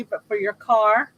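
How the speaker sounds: ordinary talk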